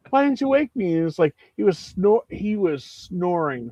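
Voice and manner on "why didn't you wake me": much higher, aggrieved whine; indignant friend. Retold or imitated. Friend complaining nobody woke him, high whiny protest. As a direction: whine it like you were robbed. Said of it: "and he's like".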